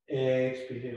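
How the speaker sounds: ordinary talk, nothing marked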